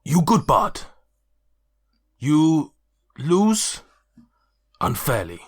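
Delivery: booming simple-minded compliment from a big orc; half-orc praise. Acted. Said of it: "her hand to Dweezil and says".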